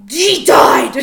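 imitating dad's very loud angry bark; angry reaction. Dad announcing a death while mom cries, mock-angry yell. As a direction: blast it out high and hard, mock-furious. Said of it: "my dad just reacts angrily to anything".

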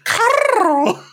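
Carl cried out high with a rolled r; rolled r moan. Pretend. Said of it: "rolling your R's for Carl when you're moaning it".

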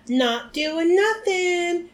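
high, slow, teasing sing-song; cackled. A narrator's reading. Peeves taunting McGonagall with a cackle. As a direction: tease the teacher from above. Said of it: "cackled Peeves".

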